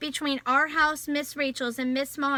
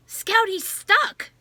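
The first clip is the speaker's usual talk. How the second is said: slow, higher, louder alarmed cry; boy gasping to his sister, slow, higher and alarmed; breathless alarm; 8 semitones higher, 6 dB louder, much slower than usual. A narrator's reading. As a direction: gasp it out in fear. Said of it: "breathed Jem".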